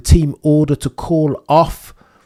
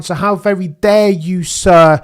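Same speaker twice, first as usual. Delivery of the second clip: higher, louder, theatrical outrage; mock indignation; 6 semitones higher, 6 dB louder than usual. Host mock-scolds Zak Brown, theatrically outraged. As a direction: hurl how very dare you.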